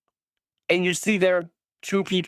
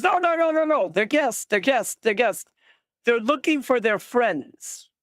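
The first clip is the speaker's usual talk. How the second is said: leader voice; 7 semitones higher than usual. Acted. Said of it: "He goes".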